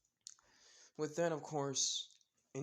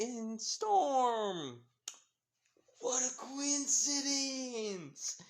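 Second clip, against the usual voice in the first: caught-out excuse; 8 semitones higher, 5 dB louder, slower than usual. Acted. Mina caught hiding, making excuses.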